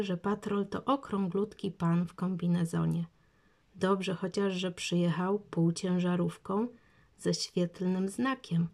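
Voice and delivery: ordinary delivery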